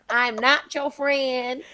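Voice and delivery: loud, drawn-out mock scolding; slow, forceful Southern mama; mother's telling-off. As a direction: imitate Mama setting you straight, very slowly. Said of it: "she tells me all the time".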